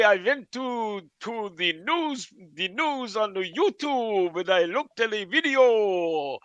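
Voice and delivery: put-on hammy Russian accent with loud bursts on key words. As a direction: put on a showy Russian accent, punching the names. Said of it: "that was a better Russian accent".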